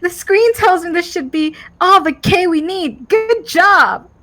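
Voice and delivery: put-on high, bubbly valley girl. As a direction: play it like a chirpy valley girl. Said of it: "That sounded more like a Quasar than a Valley Girl".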